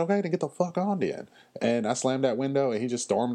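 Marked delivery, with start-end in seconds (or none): 0.0-1.3 high, sharp, dismissive snap; fed-up retort. Retold or imitated. The worker telling the rude customer to leave, in a high, fed-up snap. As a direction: shut him down for good.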